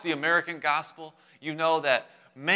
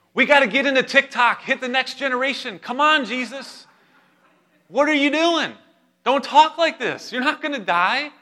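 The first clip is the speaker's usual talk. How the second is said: high, loud hammed-up exasperation; mock rebuke; 11 semitones higher, 7 dB louder than usual. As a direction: play the pushy disciple scolding his teacher. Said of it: "He began to rebuke Jesus".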